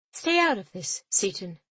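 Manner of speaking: captain snapping at her interfering mate; sharp snap. A narrator's reading. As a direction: cut him off.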